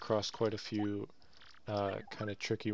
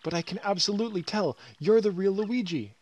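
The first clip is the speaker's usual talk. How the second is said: high, loud squealing fan voice; starstruck fan freaking out; 8 semitones higher, 9 dB louder than usual. Acted. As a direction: gush in a giddy panic at meeting your idol. Fan character meets idol Luigi.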